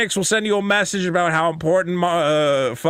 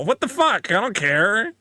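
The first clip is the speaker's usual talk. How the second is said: ordinary talk, nothing marked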